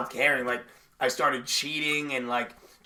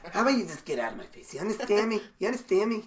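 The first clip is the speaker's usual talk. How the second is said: Sopranos mobster; 6 semitones higher, faster than usual. Acted. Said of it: "talked like the Sopranos".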